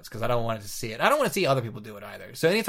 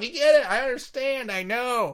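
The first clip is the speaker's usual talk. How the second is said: high, pleading protest; mock exasperation; 10 semitones higher than usual. Answering the commentator's endless replays, exasperated whine. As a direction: plead for it to stop, hammed-up.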